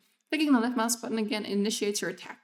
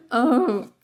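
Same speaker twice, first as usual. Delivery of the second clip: startled; 2 semitones higher, 7 dB louder than usual. Real, not acted. Right after jump scare.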